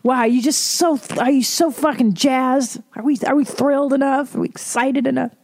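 mock the host's over-the-top excitement; sarcastic mock amazement. Mocking the gushing radio hosts.

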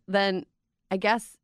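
ordinary delivery

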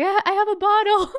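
small, high timid voice; mock-innocent high-voiced plea to the bouncer; meek high plea. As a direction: sheepishly try your luck.

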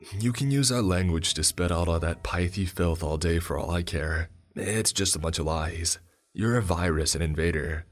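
usual speaking voice

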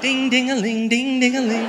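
mock ding-a-ling. Mock sound for TB cough.

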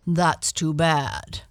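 lightly voiced shaggy bear; big shaggy bear. A narrator's reading. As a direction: sympathize slowly as a big bear. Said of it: "said the big shaggy animal".

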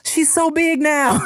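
high, slow cooing neighbor voice. Retold or imitated. Neighbor cooing at his pregnant wife's belly, high and slow. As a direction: coo it like a doting neighbor. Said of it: "and be like".

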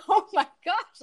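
joyful disbelief at the finish line; amazed gasp. Retold or imitated. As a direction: relive the shock of seeing the clock. Seeing her finish time.

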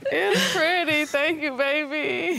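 choked up with happy tears; tearful. Real, not acted. Admiring the ring, crying.

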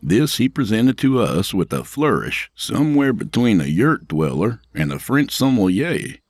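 plain reading voice

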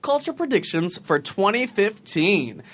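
upbeat announcer read; podcast intro read. Acted. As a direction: read it like a show promo.

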